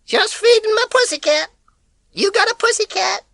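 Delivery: high, loud, breezy greeting. Acted. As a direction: sound carefree in her voice.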